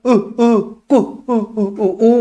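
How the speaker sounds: short, high cooing bursts; punchy high baby coos; bird-like baby cooing. Shown as a demonstration. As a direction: coo like a little bird. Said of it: "चिड़िया बन जाता है".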